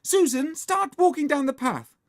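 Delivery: high, loud director's order; bossy shout. Acted. Margaret directs Susan, bossy shout. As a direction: call directions across the garden.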